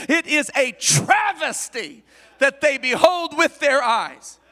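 loud, driving preacher's lament; impassioned preaching. Acted. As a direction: preach the outrage with full force. Preaching the crucifixion at force.

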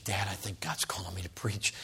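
low, near-whispered admission; hushed confession. Retold or imitated. Son tells his dad of his calling, hushed and tentative. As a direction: confide it very softly.

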